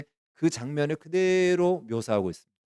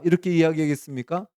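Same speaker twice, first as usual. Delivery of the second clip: ordinary talk, nothing marked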